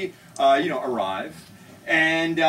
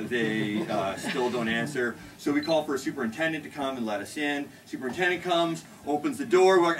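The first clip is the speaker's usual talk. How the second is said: ordinary delivery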